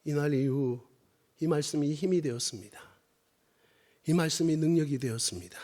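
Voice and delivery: very low, soft, halting delivery; subdued testimony. Real, not acted. Father tells congregation of his daughter's fainting, subdued testimony. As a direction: confide it quietly, with long pauses.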